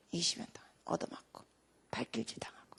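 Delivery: soft, low whisper of sorrow; hushed telling. A narrator's reading. (안타깝게 낮춘 목소리)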